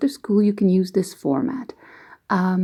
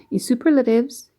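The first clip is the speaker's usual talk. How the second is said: usual speaking voice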